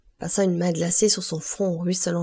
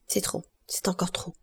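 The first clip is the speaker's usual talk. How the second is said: murmured; 6 dB quieter than usual. A narrator's reading. Villefort mutters over the letter he reads, murmured. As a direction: murmur it under the breath, softly. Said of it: "murmura Villefort".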